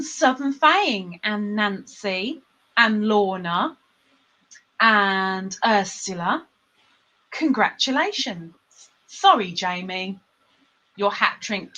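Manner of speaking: ordinary delivery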